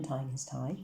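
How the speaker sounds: ordinary delivery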